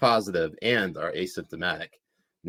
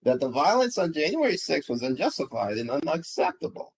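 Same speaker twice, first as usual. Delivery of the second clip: higher-pitched put-on accent for a news read; Jen Rubin accent; 6 semitones higher than usual. Host reads tweet on request, mock pundit accent. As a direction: read the headline in a raised, affected impression voice. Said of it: "do it in your, in your, uh, J-, uh, Jen Rubin accent".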